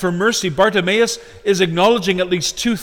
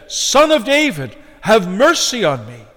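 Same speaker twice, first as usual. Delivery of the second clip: higher-pitched pleading call; 4 semitones higher, 4 dB louder than usual. Retold or imitated. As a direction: cry out for mercy, voice lifted. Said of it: "He called out to Jesus".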